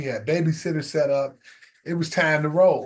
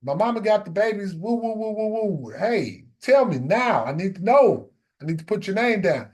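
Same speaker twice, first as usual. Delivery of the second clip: higher, fast, pushy wife voice; impatient wife; 6 semitones higher than usual. Retold or imitated. Wife pressing husband for a decision, impatient and higher. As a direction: hurry him along, teasing then insistent. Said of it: "she's like".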